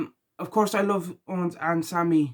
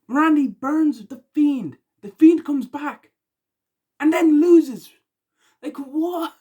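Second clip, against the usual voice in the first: much higher and louder, incredulous rant; exasperated outburst; 10 semitones higher, 7 dB louder, slower than usual. Real, not acted. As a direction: let the disbelief explode upward. Ranting about baffling match booking.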